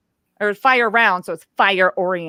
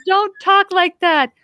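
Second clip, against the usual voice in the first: high, slow mock child complaint; mock angry kid; imitating upset son; 12 semitones higher, 4 dB louder than usual. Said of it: "He gets so mad at me".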